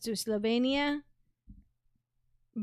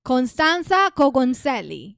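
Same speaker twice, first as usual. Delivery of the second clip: put-on Italian accent; 7 dB louder than usual. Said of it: "put your Italian accent on".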